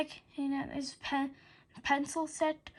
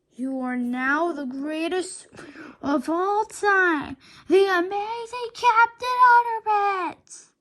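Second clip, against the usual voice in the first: slow, building higher and louder; grand proclamation; 5 semitones higher, 7 dB louder, slower than usual. A narrator's reading. George proclaiming the principal a superhero, slow grand build. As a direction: announce it like a superhero fanfare.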